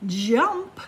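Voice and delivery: usual speaking voice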